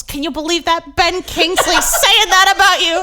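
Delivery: a proud dad's excited retelling; thrilled dad. Retold or imitated. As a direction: gush like a proud father. Dad thrilled about Ben Kingsley.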